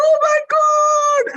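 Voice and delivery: very high, loud drawn-out squeal; tearful fan scream. Retold or imitated. Small girl running up to her idol, imitated scream. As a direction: shriek it like an overwhelmed little fan. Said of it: "running up to me with tears in her eyes".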